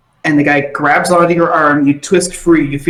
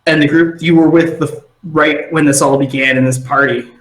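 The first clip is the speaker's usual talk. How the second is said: ordinary talk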